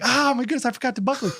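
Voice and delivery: high-pitched panicked exclamation; dad reliving the moment of realising his son was unbuckled; retold panic. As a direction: squeak it out in sudden alarm. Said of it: "I screamed 'cause I was like".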